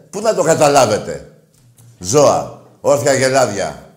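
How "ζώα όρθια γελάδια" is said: louder, slow contemptuous insult; host insulting rival fans, loud and slow. Real, not acted. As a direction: spit out the insult slowly.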